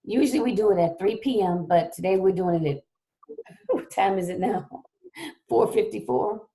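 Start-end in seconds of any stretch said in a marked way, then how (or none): none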